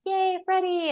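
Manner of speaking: put-on very high childish cheer; cheering kid. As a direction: squeal it like a delighted child. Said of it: "kids ... and they're like".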